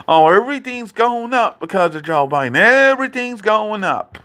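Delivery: high mock whining; imitating dad's complaint. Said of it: "he was like".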